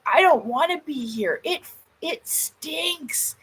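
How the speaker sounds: high, drawn-out imitated complaint about a stench; mock disgusted protest. Friend's retold protest at the stench.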